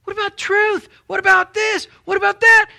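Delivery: mock outrage demanding answers; high, loud whiny complaint; indignant protest.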